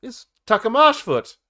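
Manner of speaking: loud, slow, pompous announcement; grand introduction. Acted. Bard introduces himself to the party with pomp. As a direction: proclaim your titles with a flourish.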